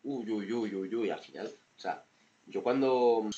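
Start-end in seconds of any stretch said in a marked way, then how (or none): none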